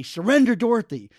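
loud, high exclamation of the name; retold cry of climax. Podcaster quoting the husband's bedroom cry, loud exclamation. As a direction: blurt it out loud, pitched high. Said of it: "he would just scream out".

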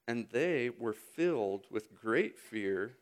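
ordinary delivery

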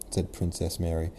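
ordinary delivery, nothing marked

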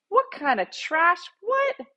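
high, rising indignant pitch, climbing on the last What; scandalized suburban mom. Retold or imitated.

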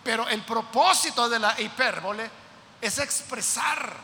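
ordinary talk, nothing marked

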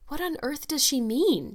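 a touch of Marilla's sharp demand. A narrator's reading. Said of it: "demanded Marilla".

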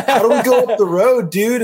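high-pitched exaggerated American accent. Shown as a demonstration. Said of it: "it in an American accent".

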